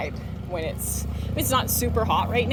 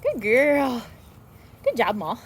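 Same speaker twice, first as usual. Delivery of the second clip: higher, louder happy praise; excited happy praise; 4 semitones higher, 4 dB louder, slower than usual. Real, not acted. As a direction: gush over the dog. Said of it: "use my excited, happy voice".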